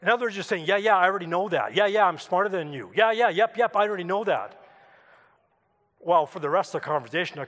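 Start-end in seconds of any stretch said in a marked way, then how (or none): 0.7-4.6 dismissive know-it-all yeahs; one-upping. Retold or imitated. Neighbour's reading of the yeahs, one-upping impatiently. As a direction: brush them off as old news, in a rush. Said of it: "you're one-upping me".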